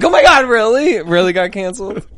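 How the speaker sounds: high, loud mock-thrilled outburst; excited reaction. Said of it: "I was so excited".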